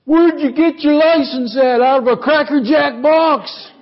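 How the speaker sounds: high mock yell with a laugh; road rage yelling. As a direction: jeer at a bad driver, pitched high. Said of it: "people yell at people".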